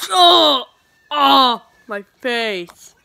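ordinary delivery

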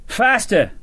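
high shouted command. A narrator's reading. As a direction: urge the line on over the noise. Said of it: "Sharp shouted".

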